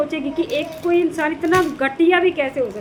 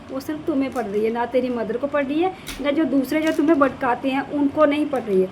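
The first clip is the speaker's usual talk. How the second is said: normal talking voice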